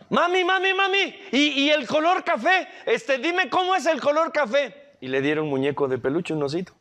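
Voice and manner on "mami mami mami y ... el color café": put-on eager child. Eager child asks mother.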